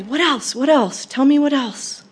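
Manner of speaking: excited grandma, each phrase swooping and punched; excited prodding. Acted. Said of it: "She would always sort of excitedly say".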